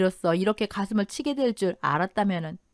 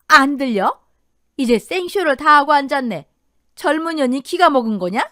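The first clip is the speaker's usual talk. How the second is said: loud, high, incredulous scolding; angry outburst; 5 semitones higher, 6 dB louder than usual. A narrator's reading. Mother-in-law explodes at daughter-in-law feigning deafness, angry outburst. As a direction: snap back loudly in outrage.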